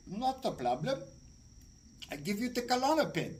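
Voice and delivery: put-on doctor with an accent; foreign doctor voice. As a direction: play the doctor who sees no problem. Retold foreign doctor's reply.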